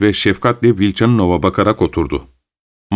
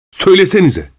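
high, raised demand; exasperated exclamation, pitched way up; shouting; 12 semitones higher, 4 dB louder than usual. A narrator's reading. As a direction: cry it out impatiently. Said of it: "diye bağırdı".

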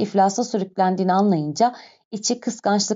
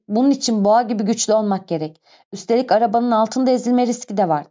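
usual speaking voice

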